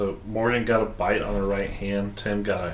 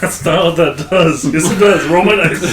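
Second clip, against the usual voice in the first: loud, high excited banter; 7 semitones higher, 9 dB louder than usual. Pretend. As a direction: ham up the duel boast. Mock card duel banter.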